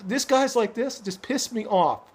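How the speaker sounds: ordinary delivery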